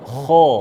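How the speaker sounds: Kho made thick and heavy from the throat. Shown as a demonstration. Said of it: "Kho-nya tebalkan". (Kho dibaca tebal dari tenggorokan)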